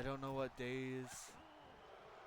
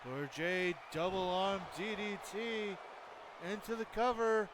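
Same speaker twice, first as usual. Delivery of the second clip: excited wrestling commentary; live play-by-play; 4 semitones higher than usual. Acted. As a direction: call the match like a ringside announcer.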